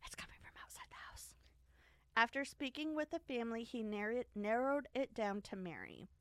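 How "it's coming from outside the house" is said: hushed, hurried horror-movie whisper; spooky whisper. Pretend. Podcast host parodies a horror-movie warning, spooky whisper.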